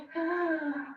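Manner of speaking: sharp frightened gasp; scared gasp. Shown as a demonstration. Teacher leading class in a scared inhale. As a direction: suck the air in like you're terrified. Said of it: "Let's go inhale, like really scared".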